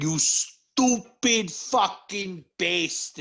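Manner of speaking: stupid fucking bastard drawn out slowly in a mock Italian-American accent; slow, hammy Italian-American accent on stupid fucking bastard; fake Italian accent. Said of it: "fake Italian [name]".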